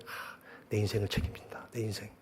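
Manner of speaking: very soft, half-whispered wonder; hushed re-enacted private thought; murmuring to himself. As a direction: let it out under your breath, as if musing privately. Retells murmuring to himself in taxi.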